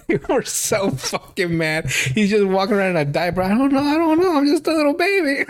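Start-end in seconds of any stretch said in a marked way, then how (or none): 3.4-5.4 put-on weird baby voice. Diaper guy pleading to the cleaning girls, squeaky baby whine. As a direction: squeak it out like a helpless toddler. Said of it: "weird baby boy".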